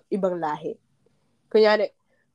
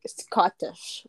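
ordinary talk

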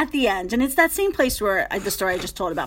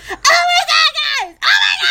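very high, loud panicked shriek; screaming; 7 dB louder, faster than usual. Retold or imitated. Imitating castmate horrified after coming offstage, screaming. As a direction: shriek it in mock horror. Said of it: "like, screaming".

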